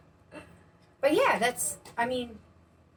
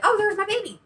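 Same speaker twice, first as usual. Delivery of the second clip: delighted, high and sweet; 11 semitones higher than usual. Retold or imitated. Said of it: "and I'd be like".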